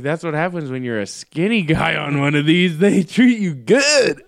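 hammed-up gleeful bragging; playful crowing. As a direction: crow about it, slowing on the last words. Crowing over free food in story.

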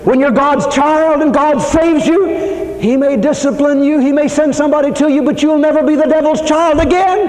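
rising sermon crescendo; preaching at full force. Acted. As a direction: hammer home that God knows. Preaching climax.

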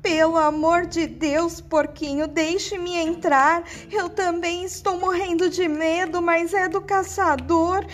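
high, tearful begging wolf; pleading whimper. Acted. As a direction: beg for your life through tears. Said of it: "começou a lamuriar e a chorar".

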